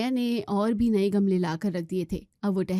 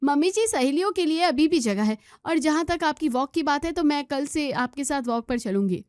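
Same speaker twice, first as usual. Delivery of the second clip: put-on higher, innocent young wife voice; young daughter-in-law; 6 semitones higher than usual. Daughter-in-law answering mother-in-law, higher young wife voice. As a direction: sweetly explain away the mess. Maya offers peace.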